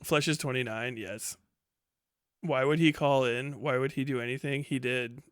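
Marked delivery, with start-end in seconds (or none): none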